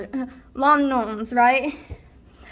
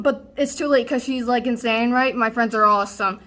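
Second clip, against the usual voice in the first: ordinary talk, nothing marked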